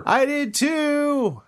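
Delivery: high, slow excited squeal; thrilled to have the same toy. Real, not acted. Excited shared find.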